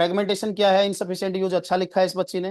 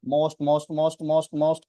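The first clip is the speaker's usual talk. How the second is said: compulsive repeating; 2 semitones lower, much slower than usual. Real, not acted. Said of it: "control भाई".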